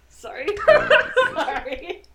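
giggling apology through laughter; can't stop laughing while apologizing. Real, not acted. Laughing at the dragon voice.

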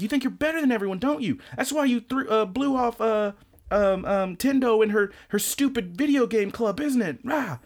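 high, whiny mock accusation; mock yelling. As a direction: throw it at him in a high mocking whine. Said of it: "He just, like, yelling at him".

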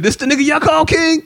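high sneering taunt; mock the king. Villain taunting the crowd, high sneering mock.

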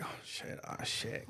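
whispered, mock-panicked gamer. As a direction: hush it like someone sneaking up mid-fight.

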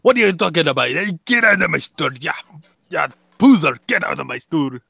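put-on alien shopkeeper accent, shooing a customer out; grumpy junk dealer kicking a Jedi out; Watto voice. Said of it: "he'll be like, "Jedi mind tricks don't work on me".

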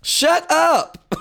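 high, emphatic "Shut up" hitting "Shut"; shut up demand. Retold or imitated. The party telling Trump to shut up, high emphatic. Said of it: "That's what the Republican Party is saying".

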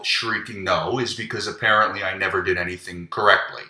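ordinary talk

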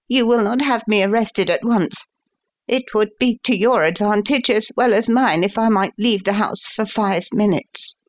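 high-pitched, earnest bargaining; appealing son. A narrator's reading. Son asking father for five minutes' grace, appealing.

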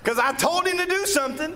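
high, strained mock scolding of a child; high exasperated scolding. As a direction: squeal it like a fed-up parent. Retelling scolding her child.

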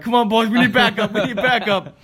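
high, urgent rallying call, laughing it off; calling the dogs. Retold or imitated. Retelling a call to the dogs for help, high and urgent. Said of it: "I was talking to the dogs".

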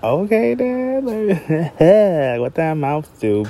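high, teasing sassy call-out; sassy mock quip. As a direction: tease him with a high sassy jab. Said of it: "I wanted to be like".

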